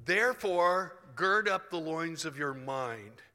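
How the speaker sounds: emphatic scripture reading. Acted. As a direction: proclaim the verse, stretching it out. Reads scripture aloud emphatically.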